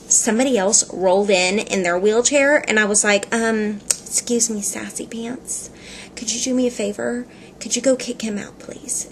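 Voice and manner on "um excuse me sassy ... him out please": hushed, sinking into a whisper; a confidential favor asked under the breath; mock-discreet request. Customer asks shop guy to kick someone out, hushed.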